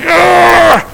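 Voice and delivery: high annoyed growl; frustrated mock growl. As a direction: let out a fed-up groan. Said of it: "all that cynicism".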